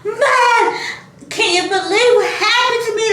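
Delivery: high, laughing distressed plea; half-laugh half-wail to mom; upset call to mom. Retold or imitated. Telling mom on the phone about the bird, high and upset. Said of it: "I'm calling my mom".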